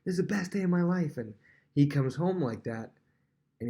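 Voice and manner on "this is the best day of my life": higher-pitched, imitated elation. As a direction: gush like someone who just won the championship. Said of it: "on a high".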